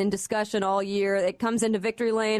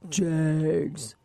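low, drawn-out Jegs; deep voice; 6 semitones lower than usual. Shown as a demonstration. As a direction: drop deep and stretch "Jegs". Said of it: "You have to say it with that deep voice".

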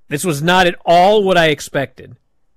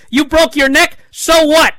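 mock angry scoff; 12 semitones higher, 5 dB louder than usual. Kofi scoffs at Big E.

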